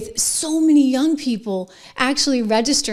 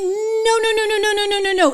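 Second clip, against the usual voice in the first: rapid string of high nos; emphatic no; 11 semitones higher than usual. Real, not acted. Host answering his own question, with emphatic rapid nos. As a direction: hammer the denial, pitched high. Denying the other side acts right.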